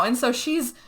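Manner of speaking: plain reading voice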